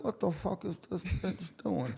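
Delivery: quiet mock aside at a stranger; muttered aside. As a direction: mutter it to yourself.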